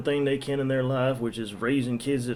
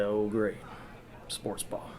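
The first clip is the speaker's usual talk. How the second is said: deadpan, dropped to a near whisper; unimpressed mutter, trailing off; sarcastic; 3 semitones lower, 9 dB quieter, slower than usual. Retold or imitated. Bartender asks about sports, he mutters sarcastically.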